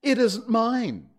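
high, loud, drawn-out protest; slow, high-pitched objection; protesting Hatter. A narrator's reading. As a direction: protest your innocence in a high voice.

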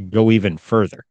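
usual speaking voice

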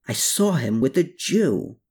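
ordinary delivery, nothing marked

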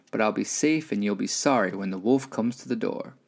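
usual speaking voice

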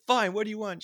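high, loud exasperated mother; tired mom voice. Retold or imitated. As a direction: give in like a weary parent. Mom answering the kid.

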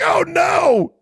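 high loud cry of horror; mock horror. Host imitating watching the cat fall, high shouted alarm. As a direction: yell it as the cat falls. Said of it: "You're like".

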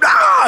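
high shocked yelp of pain; the professor's startled cry; startled scream. Retold or imitated. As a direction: shriek as if deafened. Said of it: "sort of screamed and went backwards".

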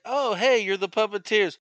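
lifted, sing-out hello; chirpy Dee Dee quote. Retold or imitated. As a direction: mimic an excited woman spotting someone, pitch up. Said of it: "Dee Dee's like".

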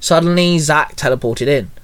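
usual speaking voice

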